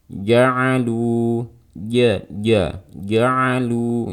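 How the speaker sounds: "ja'alu" said as ya'alu, with long vowels; jim turned to ya. Shown as a demonstration. Teacher showing what not to do, y-like jim in "ja'alu". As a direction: swap the j of "ja'alu" for a y glide. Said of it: "nggak kayak gitu".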